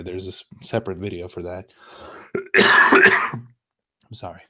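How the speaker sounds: hoarse, failing voice; losing voice. Real, not acted. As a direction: voice giving out, raspy. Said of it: "I'm losing my voice here".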